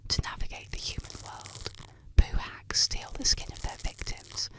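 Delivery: ordinary delivery, nothing marked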